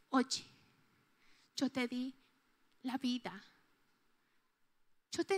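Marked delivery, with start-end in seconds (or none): 0.1-3.4 lower, much softer and intimate; soft tender voice. Acted. God speaking tenderly to the heart, lower and soft. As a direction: murmur it like a gift. Voicing God's gentle words.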